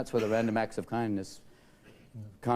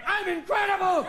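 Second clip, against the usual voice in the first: high, loud, slow boast; mock ecstatic cry; 5 dB louder than usual. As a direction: bellow it like a smug lover. Somebody having sex during quake.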